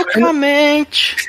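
high, slow eunuch word. Pretend. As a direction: stretch it slowly in a high voice. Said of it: "Então fala".

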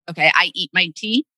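ordinary talk

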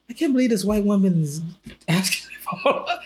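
high-pitched, amused incredulity; retold laughing disbelief. Host recalling the muted phone call, laughing in disbelief. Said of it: "but I actually started laughing like".

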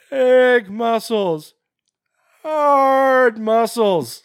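high, loud put-on character voice, stretching the big words; Mark McKinney. Host quoting Brain Candy to co-host, loud hammed-up impression. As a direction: belt it out in an over-the-top comic voice. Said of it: "the voice that he's doing does kinda sound like Patrick Warburton".

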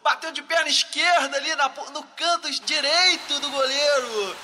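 explosive goal commentary. Pretend. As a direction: stretch the goal call out. Goal shout in mock narration.

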